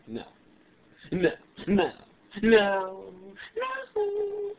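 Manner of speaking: escalating no, high at the end; repeated no. Retold or imitated. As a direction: push him away, rising to a squeal. Refusing his kiss again.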